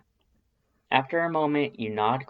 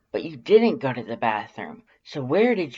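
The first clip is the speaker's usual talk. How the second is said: insistent; 2 semitones higher, 5 dB louder than usual. A narrator's reading. Undyne pressing question.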